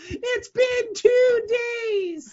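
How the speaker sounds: high, slow whining lament; mock wail. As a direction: whimper it like a prisoner. Acting trapped in Phantom Zone.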